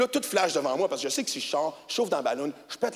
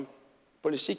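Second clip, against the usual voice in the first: normal talking voice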